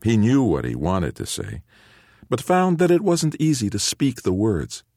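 plain reading voice

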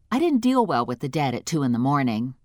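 ordinary delivery

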